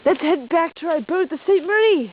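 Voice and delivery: goofy put-on Australian voice; hammed-up Aussie explorer accent; mock Australian.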